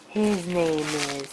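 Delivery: usual speaking voice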